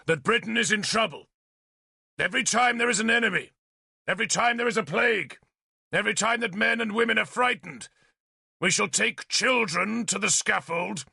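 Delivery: high, ringing, insistent declaration; speaking loudly. A narrator's reading. Arthur challenging Merlin before everyone, speaking loudly. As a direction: build the list for the whole crowd. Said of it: "Arthur spoke loudly".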